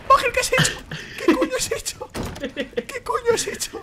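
very high, laughing disbelief; shrieking laughter. Real, not acted. Gamer asking friend what he has done, shrieking with laughter. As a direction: squeal it through helpless laughter. Incredulous at partner's blunder.

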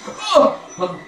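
ordinary talk

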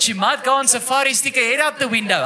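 ordinary delivery

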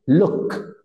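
"Look" ending in an open-mouthed, clear K; crisp final K. Shown as a demonstration. Teacher modelling the final K for the student. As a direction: snap the K of "Look" with teeth apart. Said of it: "Pronúnciame la K de kilo al final".

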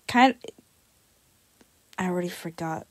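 ordinary talk, nothing marked

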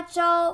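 招 held high and level; flat tone. Shown as a demonstration. Teacher showing learners the word for recruit, level tone on 招. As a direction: keep the pitch of 招 steady and high.

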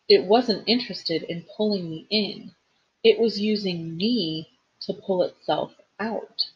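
usual speaking voice

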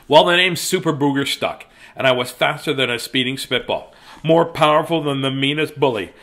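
plain reading voice

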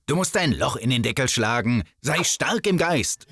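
ordinary delivery

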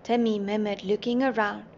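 ordinary delivery, nothing marked

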